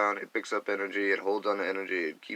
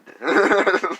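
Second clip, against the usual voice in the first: loud laugh; 12 semitones higher, 13 dB louder than usual. Real, not acted. Laughing at mocked healer.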